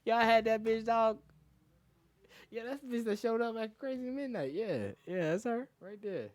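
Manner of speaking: imitating gleeful gossip; teasing friends. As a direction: needle the guys with glee. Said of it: "I'm like".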